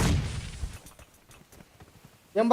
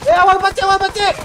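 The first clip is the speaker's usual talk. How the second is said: loud, high urgent warning; shouting; 13 semitones higher, 11 dB louder, faster than usual. Real, not acted. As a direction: shout it out fast to your teammate.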